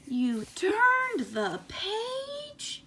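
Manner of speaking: Grover's cry. Retold or imitated. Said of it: "begging you".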